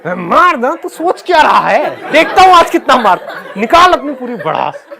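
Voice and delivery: high, loud re-enacted dare; worked-up shouting taunt; loud defiant challenge. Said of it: "और बहुत तेज मैं बोल रहा था".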